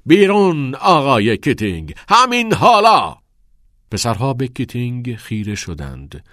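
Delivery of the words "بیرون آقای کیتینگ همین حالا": loud command, climbing much higher; furious shout. A narrator's reading. Nolan throws Keating out of class, furious shout. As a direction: bellow the dismissal, rising to a peak. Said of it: "آقای نولان فریاد کشید".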